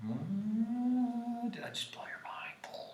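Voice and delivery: breathy mock-mysterious whisper; teasing whisper. Real, not acted. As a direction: hush it like a secret. Teasing students.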